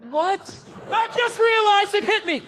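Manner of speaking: high excited laugh through the words; laughing realization. Real, not acted. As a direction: blurt it out laughing.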